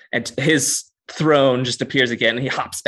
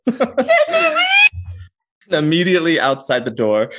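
ordinary talk

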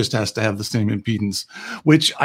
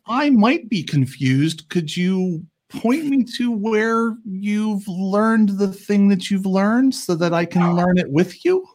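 mock innocent; 7 semitones higher, slower than usual. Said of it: "What I basically said was".

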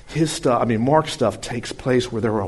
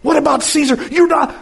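anxious followers; 14 semitones higher, 6 dB louder than usual. Acted. Frightened followers questioning Jesus.

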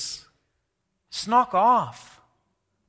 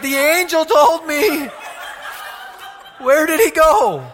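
mock-panicked Mary; 7 semitones higher, 6 dB louder than usual. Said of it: "she lost the Messiah".

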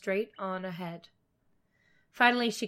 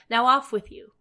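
louder, higher brusque send-off; brusque dismissal; 4 semitones higher, 8 dB louder than usual. A narrator's reading. Stepmother sending the girl into the forest, brusque dismissal. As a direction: send the child off briskly and loudly.

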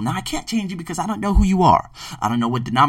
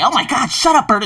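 high-pitched irritated outburst; mock angry; 9 semitones higher, 3 dB louder than usual. Angry people yelling at a laughing bird, high mock anger. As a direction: snap it high, imitating an annoyed listener. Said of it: "people that are angry and say".

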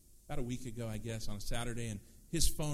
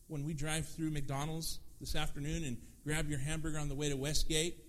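ordinary delivery

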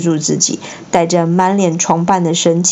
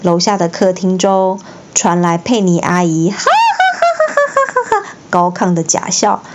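plain reading voice

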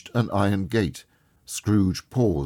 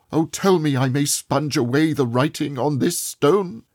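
higher, louder fervent promise; crying out a vow; 9 semitones higher, 5 dB louder than usual. A narrator's reading. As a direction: swear it with all his heart. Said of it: "Scrooge cried".

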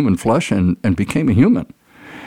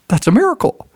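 amazed man; 14 semitones higher than usual. Acted. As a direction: marvel at it like a child. Man amazed by God walking.

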